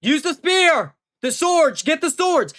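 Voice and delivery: high, loud frantic commands; panicky villain. Retold or imitated. Reviewer imitates Pattinson's villain yelling orders in panic, high and loud. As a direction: bark out orders in a scared panic. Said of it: "very funny at the end of the movie when he's like".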